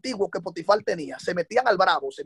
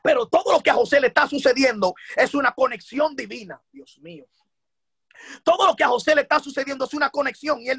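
raised, high-pitched sermon delivery; impassioned preaching; 6 semitones higher than usual. Real, not acted. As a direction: drive each phrase home to the congregation, pitched up. Preacher lifts into forceful preaching.

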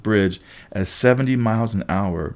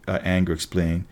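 ordinary delivery

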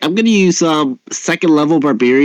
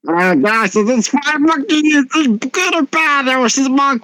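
high-pitched put-on adventurer voice; high, confused-sounding roleplay voice; in-character question; 10 semitones higher than usual. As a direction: ask the party in a high character voice. [name] asks party in character.

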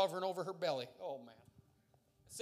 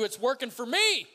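loud, high preacher's climax; exultant cry at the top of the build; triumphant shout; 6 semitones higher, 10 dB louder than usual. Real, not acted. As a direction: shout it out in full faith. Climax of faith declaration.

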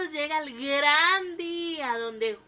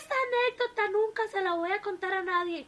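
the wife teases her hurt husband; raised wife voice, sly; teasing wife; 8 semitones higher, 4 dB louder than usual. A narrator's reading. Wife teases husband after fall.

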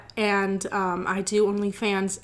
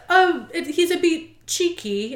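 put-on Cockney accent; 7 semitones higher, slower than usual. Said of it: "The Cockney accent?".

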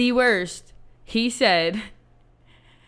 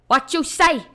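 put-on higher, louder Staten Island accent; mock Staten Island tough guy; 6 semitones higher, 3 dB louder than usual. Imitating the drunk guy's Staten Island accent in a high, loud bark. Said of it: "his accents kept changing".

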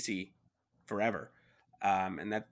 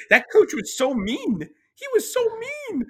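loud, very high squeal of protest; high-pitched exclamation; 9 dB louder than usual. Real, not acted. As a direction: cry it out in a high voice, stretching the words. Mock outcry about the mean coach.

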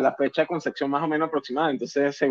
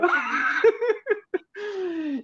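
a burst of much higher laughter. Real, not acted. Telling friends about the scary climb, bursting into high laughter. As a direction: crack up high at the memory.

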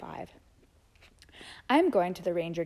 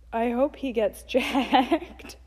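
laughing; 4 semitones higher, 4 dB louder, much slower than usual. Real, not acted. Laughing at childhood journal.

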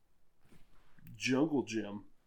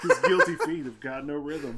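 laughing; 7 dB louder than usual. Pretend. Continuing [name] lyric.

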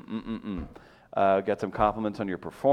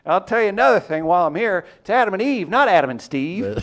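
loud, exaggerated complaining persona; 8 semitones higher, 6 dB louder than usual. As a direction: rant like an angry caller. Mock bigot persona.

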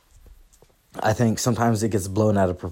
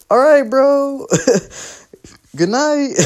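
high, louder goofy bro imitation; higher imitation of a stranger saying good night; mock dude voice; 5 dB louder, slower than usual. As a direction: do a squeaky, laid-back bro signing off. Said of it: "he's like".

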